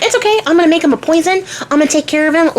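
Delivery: high, singsong "leave it to me"; high-voiced casual scheming; breezy reassurance. Medea breezily reassures the king.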